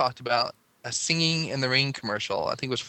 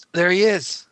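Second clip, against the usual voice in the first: surprised find; 7 semitones higher, 7 dB louder, slower than usual. Real, not acted. Spotting the forgotten ad man online, a loud surprised cry. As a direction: burst out higher and louder, delighted.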